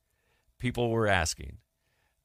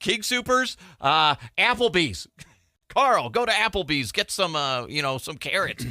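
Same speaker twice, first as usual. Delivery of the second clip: higher, hammed-up scrambling staffer; mock scrambling staffer; 7 semitones higher than usual. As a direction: play the frantic staffer calling out orders.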